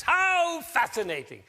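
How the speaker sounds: high, loud, drawn-out exclamation of delight; celebratory. Shown as a demonstration. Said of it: "the way to celebrate is like this".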